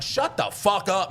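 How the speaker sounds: high, drawn-out snap; retold angry outburst. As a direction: act out a sudden flare of temper. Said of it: "I'm getting angry again".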